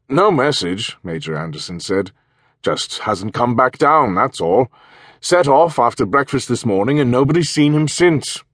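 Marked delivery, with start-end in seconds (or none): 0.1-1.0 upper-class English. Acted. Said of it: "in a throaty, upper-class English voice". 2.6-8.4 upper-class English. Acted. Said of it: "in a throaty, upper-class English voice".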